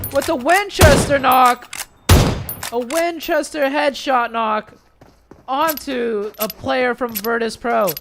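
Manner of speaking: loud, high excited play call; hype shout. Real, not acted. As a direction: yell it out like a big highlight. Caster calls a headshot knock.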